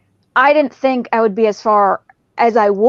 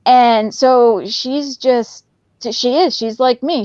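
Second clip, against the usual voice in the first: usual speaking voice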